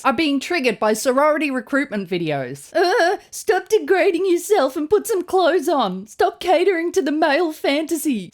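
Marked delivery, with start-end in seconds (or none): 2.8-8.3 hammed-up preachy scold; mocking scold. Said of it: "Said no hot bitch ever".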